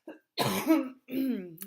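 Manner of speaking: long loud coughing fit; real hacking cough. Real, not acted. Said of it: "I still cough too".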